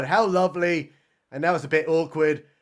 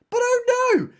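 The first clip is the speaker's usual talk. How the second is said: hammed-up alarm, pitched way up; mock dread; 13 semitones higher than usual. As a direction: wail it like a cheesy cliffhanger. Mock dread at more eagles.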